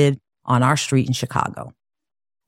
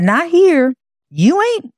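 high, insistent sibling rebuttal; emphatic contradiction; 13 semitones higher, 4 dB louder than usual. Retold or imitated. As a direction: shut the kid down, pitched way up. Older brother correcting her, retold.

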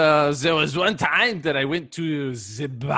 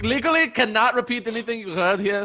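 fast; 3 semitones higher, faster than usual. Acted. Rushed warning.

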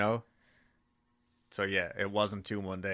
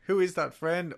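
put-on high little kid voice; child voice; 9 semitones higher than usual. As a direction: play the toddler asking. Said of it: "was it".

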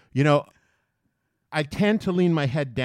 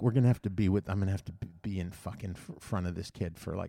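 low, much softer aside; retold grumble; 6 semitones lower, 8 dB quieter than usual. Man dreading the wait behind the kid, low private grumble. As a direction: grumble privately under the breath.

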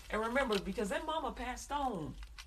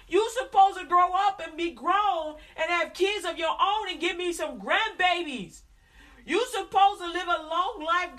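loud, high, scolding mother's rant; furious mama scolding; 8 semitones higher, 8 dB louder than usual. Retold or imitated. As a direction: tear into the child like an angry mama. Angry mother scolding child.

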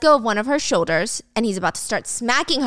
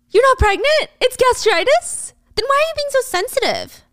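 much higher, loud and incredulous; shocked high mock disbelief; 13 semitones higher, 4 dB louder than usual. Michael hearing she's not pregnant, incredulous and high.

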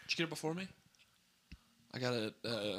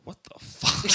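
mock baffled girlfriend; 9 semitones higher, 12 dB louder, much slower than usual. Courtney's retold reaction.

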